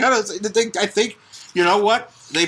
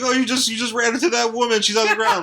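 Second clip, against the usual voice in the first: higher-pitched playful reaction; mock alarm; 9 semitones higher than usual. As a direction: act out a bystander's alarm, jokingly. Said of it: "it's like".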